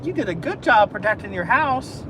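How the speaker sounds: re-enacting high-pitched pet praise; super-happy dog talk. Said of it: "super happy to 'em".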